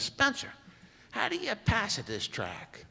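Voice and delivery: high put-on voice of the official; mimicked official. Impersonating official asking question.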